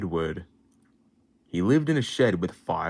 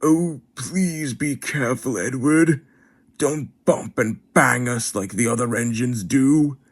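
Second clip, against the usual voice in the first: higher, pleading coach voice; pleading coaches; 6 semitones higher, 3 dB louder than usual. Acted. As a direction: beg the engine to be gentle. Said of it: "and they said".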